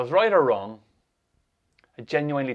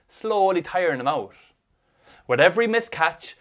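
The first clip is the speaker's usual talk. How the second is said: ordinary delivery, nothing marked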